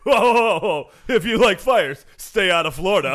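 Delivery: loud, high Kramer-style entrance; sitcom catchphrase shout. Acted. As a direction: burst in like a sitcom neighbor.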